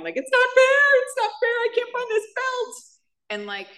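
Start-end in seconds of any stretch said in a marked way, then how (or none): 0.2-2.7 high, wailing mock sob of a grieving daughter; scream crying. Recalling grief over her father, a high wailing cry. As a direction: wail it like a heartbroken child. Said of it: "scream crying".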